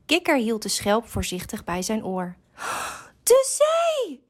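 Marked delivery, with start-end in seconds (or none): 3.2-4.3 hoge, verraste uitroep; opgewonden. A narrator's reading. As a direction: roep het hoog uit van opwinding. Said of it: "zei hij opgewonden". (opgewonden, hoog kikkerstemmetje)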